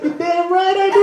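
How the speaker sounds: high, cocky proud reply; proud boast. Retold or imitated. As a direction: brag it gleefully. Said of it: "I was like".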